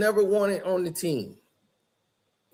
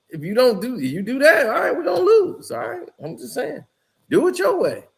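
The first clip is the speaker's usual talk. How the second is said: much higher, sarcastic imitation with a laugh; mocking coach; 7 semitones higher than usual. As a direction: shrug him off, half laughing. Coach mocks player's way.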